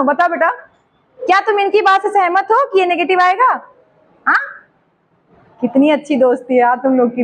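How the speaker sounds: ordinary talk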